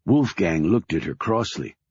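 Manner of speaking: plain reading voice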